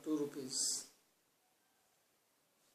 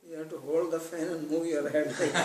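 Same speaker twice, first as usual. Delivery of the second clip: punchline; 11 dB louder than usual. Acted. Delivering the fan joke punchline.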